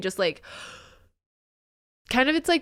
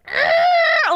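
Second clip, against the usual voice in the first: high, loud squeezed growl at the cats; a shrill burst of cute aggression; overwhelmed by cuteness, a loud strangled arr; 8 dB louder than usual. Retold or imitated. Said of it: "I'm just like".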